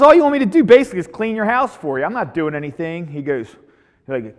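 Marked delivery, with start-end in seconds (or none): 0.0-3.1 louder, higher, fast and fed up; frustrated complaint. Acted. Daniel complaining to Miyagi about cleaning, fast and fed up. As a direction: blurt out the grievance, exasperated.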